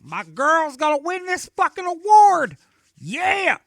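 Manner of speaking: high, loud whooping cheer; giddy shouting fan. Retold or imitated. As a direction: cheer like your idol's about to win. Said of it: "He's all excited".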